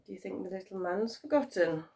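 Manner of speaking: plain reading voice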